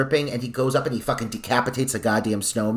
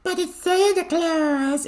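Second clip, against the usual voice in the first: put-on much higher, slow, delighted child voice; little girl voice; slower than usual. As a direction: squeal it like an excited little girl. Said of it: "she's like".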